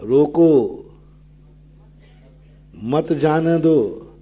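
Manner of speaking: रोको, then a long pause before मत जाने दो; रोको on its own falling tune, a break, then मत जाने दो; pause after रोको. Shown as a demonstration. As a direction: set रोको apart, keep मत with जाने दो. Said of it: "स्वल्प विराम में भी अंतर".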